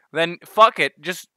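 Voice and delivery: ordinary delivery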